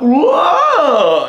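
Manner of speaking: high, loud cartoon whoa; yelled whoa. Developer yelling a character sound, high loud cartoon whoa. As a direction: yell it like a goofy game character. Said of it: "just yelled out".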